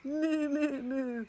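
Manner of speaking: high loud mooing; cow moo. Acted. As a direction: moo it out big. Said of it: "the duck on the pond said".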